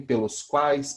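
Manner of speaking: ordinary talk, nothing marked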